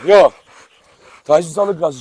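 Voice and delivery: ordinary delivery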